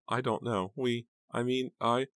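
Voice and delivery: high, faltering, helpless stammer; aunt falling apart. A narrator's reading. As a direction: stumble for words in a high, distraught voice. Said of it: "started to fall apart again".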